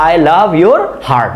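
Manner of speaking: I love your heart read very slowly, stressing your; drawn-out model sentence; slow example. Shown as a demonstration. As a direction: dictate it slowly to the class. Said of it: "ইয়োরের পরে কিন্তু একটা heart নিয়েছি".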